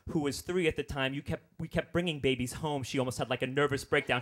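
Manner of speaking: usual speaking voice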